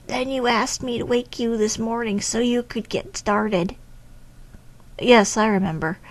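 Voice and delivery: normal talking voice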